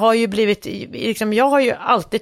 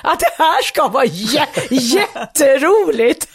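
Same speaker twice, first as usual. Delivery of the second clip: higher, slow and affected through a laugh; mock highbrow amusement; 4 semitones higher, slower than usual. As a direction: play a pretentious viewer forcing amusement. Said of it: "så här intellektuella skratt". (tillgjort, långsamt och högt, som en pretentiös biobesökare)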